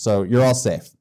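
ordinary talk, nothing marked

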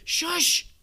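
sharp, high, loud shush. Retold or imitated. Disciples silencing the beggar, loud shush. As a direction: hush him hard and loud. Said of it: "They say".

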